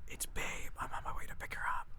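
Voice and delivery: fast gossiping whisper. Acted. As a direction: hiss the secret quickly. Chick whispers Babe's news.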